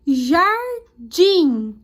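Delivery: jardim split in two, punched on DIM; teacher sounding out "jardim", stress on the last syllable; stressed syllable demo. Shown as a demonstration. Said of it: "falou com mais força".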